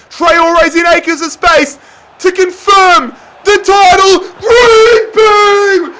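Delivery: celebrating shout for the clinching goal; title-winning roar. Real, not acted. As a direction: roar it till your voice cracks. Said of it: "I'm gonna lose my bloody voice".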